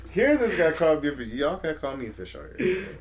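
loud, high mock outrage; protesting loudly with indignation. Real, not acted. Protesting being called a fish.

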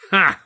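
a short scornful ha; derisive snort. Acted. Arthur scoffing at Stan's defence of Charlie, derisive. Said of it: "I snorted derisively".

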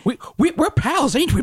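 very high, stammering cartoon sidekick; Looney Tunes little dog. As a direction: play the eager little dog seeking friendship. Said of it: "little dog".

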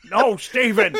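loud, high scolding; mock exasperated host. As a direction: snap back at Steven. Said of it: "mock host correcting".

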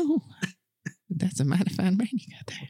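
high creepy whisper. Acted. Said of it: "are you still doing it".

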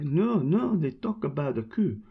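put-on high, insistent French-accented voice. French woman explaining the song to the pal, high in a French accent. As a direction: correct him in a French accent. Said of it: "try a French accent here".

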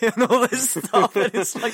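high, loud words breaking into laughter; laughing. Real, not acted. Podcaster recalling a laughing injured stuntman, cracking up. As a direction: crack up mid-story.

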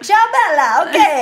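high, loud mock Italian; Italian accent. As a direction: ham up the Italian greeting. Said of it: "We were like".

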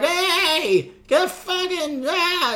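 hammed-up heckling from the crowd; drunk heckler yell. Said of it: "they're, like, yelling at me".

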